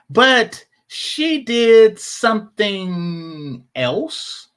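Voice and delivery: very slow, stretched-out words; drawn out, holding back the reveal; teasing build-up. Real, not acted. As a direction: drag out each word to build suspense.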